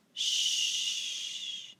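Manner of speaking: "sh" drawn out long, no voice; sustained "shhh" with no vowel; SH sound. Shown as a demonstration. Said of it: "It's S H".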